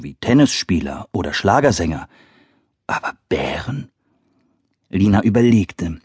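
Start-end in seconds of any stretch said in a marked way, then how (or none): 2.9-3.9 breathy hushed whisper. A narrator's reading. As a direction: whisper it like a secret. Narrator whispers a surprised aside.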